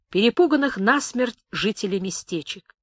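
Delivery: usual speaking voice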